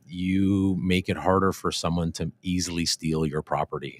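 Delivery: normal talking voice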